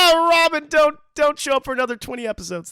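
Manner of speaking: ordinary talk, nothing marked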